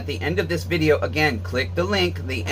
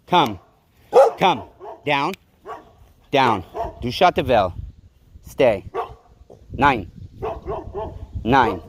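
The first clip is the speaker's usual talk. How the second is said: single firm words, falling in pitch; dog commands; 2 semitones lower than usual. Real, not acted. Owner ordering her dog down mid-argument, firm commands. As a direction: command the dog, one word at a time.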